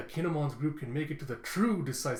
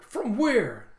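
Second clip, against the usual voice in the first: high, loud burst of surprise; startled question; 9 semitones higher, 8 dB louder than usual. Retold or imitated. Crew hearing Zoro smells booze, startled question. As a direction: blurt it out, astonished.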